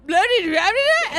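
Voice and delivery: loud babble shooting up high; twin gibberish. Retold or imitated. Two drunk friends talking to each other, loud gibberish. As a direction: imitate drunk friends babbling like baby twins. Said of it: "it was actual literal gibberish".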